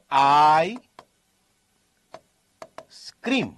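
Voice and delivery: આઈ held long, long pause, then સ્ક્રીમ; split as "I scream". Shown as a demonstration. Teacher showing how the sentence is said, split આઈ / સ્ક્રીમ. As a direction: separate આઈ from સ્ક્રીમ, keeping S on the second word. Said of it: "બોલાય કેમ?".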